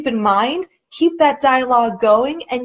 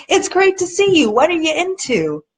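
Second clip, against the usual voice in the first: higher, lively welcome; friendly greeting; 5 semitones higher than usual. Retold or imitated. Father welcoming his estranged daughter, brightly upbeat. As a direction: greet her like it's good news. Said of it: "No, he says".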